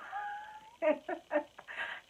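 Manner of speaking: high, helpless laughter; real high-pitched giggling fit; laughing. Real, not acted. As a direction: crack up at your own story. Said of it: "I'm laughing now".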